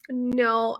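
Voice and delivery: usual speaking voice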